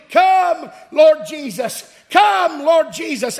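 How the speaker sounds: high, loud preached plea; pleading prayer cry. Acted. Preacher voicing believers begging for his return, crying out. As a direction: call it out to heaven, drawing out the name. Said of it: "our number one prayer request".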